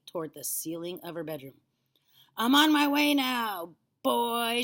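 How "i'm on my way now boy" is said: slow, high, loud call. A narrator's reading. Grandma rising, calling down to boy, loud call. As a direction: call down from far above. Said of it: "she called down to George".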